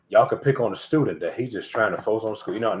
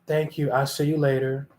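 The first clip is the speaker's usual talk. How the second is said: fake-sweet goodbye; 5 semitones higher than usual. Two-faced friend says goodbye.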